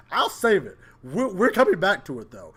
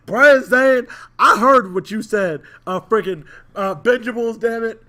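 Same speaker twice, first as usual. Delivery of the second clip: loud, higher-pitched call on the name; calling someone out; 4 semitones higher, 6 dB louder than usual. Real, not acted. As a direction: call out your critic loudly. Calling out an absent critic.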